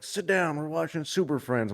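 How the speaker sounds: a hint of a stern father; dad calling out. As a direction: imitate dad summoning the kid. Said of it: "He's like".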